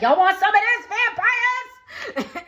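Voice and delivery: loud, high taunting challenge with a laugh; defiant taunt. Retold or imitated. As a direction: yell it out daring them to come. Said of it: "He cuts himself and he's like".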